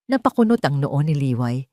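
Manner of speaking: ordinary delivery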